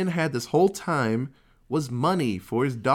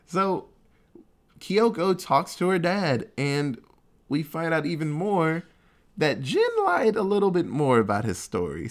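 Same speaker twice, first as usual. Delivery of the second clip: usual speaking voice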